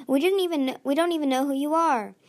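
protesting. A narrator's reading. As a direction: insist you are innocent.

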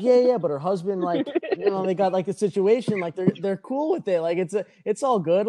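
high-pitched, reassuring justification; pleading explanation. Retold or imitated. Patron explains the husband's consent to a bouncer, pleading.

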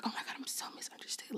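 very soft, quick whispered mock lament; whispered self-mimicry. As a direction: whisper it hurriedly, imitating your own complaint. Mimics own complaint she dislikes.

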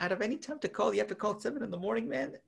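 high, fast exasperated complaint; exasperated rant. Retold or imitated. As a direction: complain in disbelief, high and quick. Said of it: "I'm like".

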